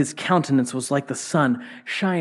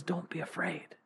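hushed reassurance; 16 dB quieter than usual. Acted. God's words repeated in sermon.

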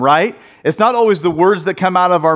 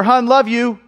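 higher, tossed-off goodbye; offhand love you; 5 semitones higher than usual. Acted. Husband walking out the door to wife, offhand. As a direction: toss it out as you leave. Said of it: "I'm walking out the door".

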